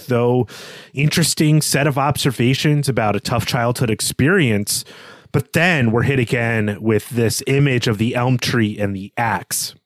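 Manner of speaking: ordinary talk, nothing marked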